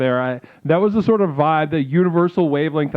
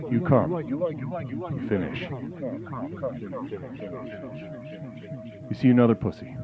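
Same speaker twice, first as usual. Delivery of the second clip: mock guided meditation; 5 semitones lower, 9 dB quieter than usual. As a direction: intone each line softly like a meditation guide. Parody guided meditation mantra.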